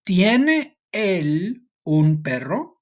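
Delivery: drawn out, slow and clear; slow girl's question. Shown as a demonstration. Teacher reading the girl's question to the class, slow. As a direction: give the question slowly for learners. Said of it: "So she says".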